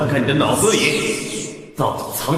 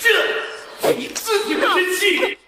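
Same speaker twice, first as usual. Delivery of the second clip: gloating laugh. Acted. Villain gloating over beaten foe.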